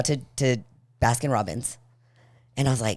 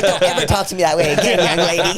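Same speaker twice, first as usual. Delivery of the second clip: loud, raised-pitch telling-off through laughter; mock scolding mom; 6 semitones higher, 10 dB louder than usual. Mom to her cheeky daughter, mock scolding. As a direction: lay down the law, half laughing.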